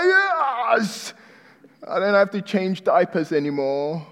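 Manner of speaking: excited yes. Retold or imitated. Father cheering his son's potty training, high and excited. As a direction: cheer like a relieved parent.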